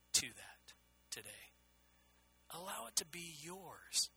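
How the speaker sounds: hushed prayer, handing it all over; quiet prayer. Acted. Quiet prayer quoted to God.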